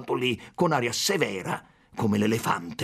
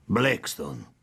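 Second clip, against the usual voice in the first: understated, sober reply; contrite, demure; 3 semitones lower than usual. A narrator's reading. Consul correcting the teasing about his name, mildly demure. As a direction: answer with mild solemn restraint. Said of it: "rispose con aria compunta".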